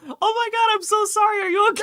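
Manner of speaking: high, loud, gushing apology; fussing apology to cat. Retold or imitated. Co-host gushing sorry to her cat after it trips her. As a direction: gush apologies to a pet. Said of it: "I'm like".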